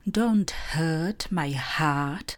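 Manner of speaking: very slow, clear dictation; slow model sentence for students; slow example. Shown as a demonstration. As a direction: spell it out word by word. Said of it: "Například".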